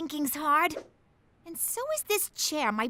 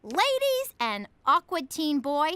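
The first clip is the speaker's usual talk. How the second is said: infomercial pitch voice. Acted. Announces product to audience.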